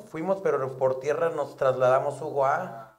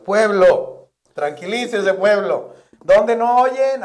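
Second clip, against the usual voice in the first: loud, higher stage call, slowing on the question; calling to crowd; 7 semitones higher, 11 dB louder, slower than usual. Retold or imitated. The comic calms the palenque crowd without sound, loud high calling. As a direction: hail the whole arena over dead speakers.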